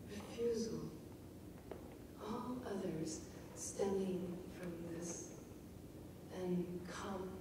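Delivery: slow, hushed poetry reading; poem reading. Acted. Teacher reading the poem to the class, hushed and slow.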